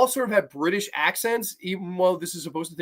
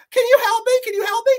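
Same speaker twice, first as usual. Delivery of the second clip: mock damsel; 6 dB louder, faster than usual. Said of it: "she's like".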